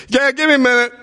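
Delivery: put-on high, far-off teen reply; lightly voiced son; son answering. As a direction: play the son upstairs. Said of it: "I yelled upstairs".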